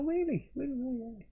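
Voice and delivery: high-pitched imitation of keen interest; mock surprise. Stranger hears he's an artist, imitated surprise. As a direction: imitate a stranger suddenly intrigued, voice jumping high.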